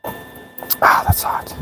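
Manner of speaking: pained whisper; burnt fingertips. Real, not acted. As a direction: hiss it through the burn. Burns fingers on hot metal.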